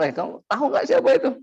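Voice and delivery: high, fast voice of an urgent bystander; excited bystander. Retold or imitated. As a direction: blurt it out like a shocked onlooker. Bystander tells soldier who. (suara orang yang kaget dan tergesa-gesa)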